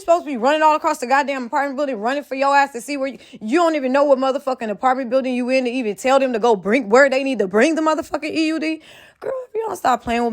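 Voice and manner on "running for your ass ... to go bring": ordinary talk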